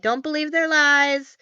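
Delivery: recapped cartoon outburst, pushed up a bit; mock warning. Acting out bird rallying tourists.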